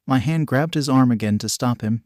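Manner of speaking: usual speaking voice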